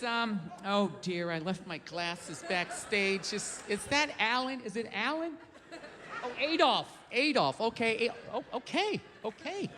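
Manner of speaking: low, slow, soft doddering host who lost his glasses; squinting old emcee mumbling through the lineup; fumbling old announcer. Retold or imitated. Old rally host fumbling the speaker's name, low and slow. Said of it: "announcer introducing next speaker".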